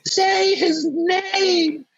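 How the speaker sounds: very high, slow falsetto queen; mock queen voice. Queen goading the losing prime minister, very high mocking voice. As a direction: draw it out high like a teasing old monarch. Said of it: "just going".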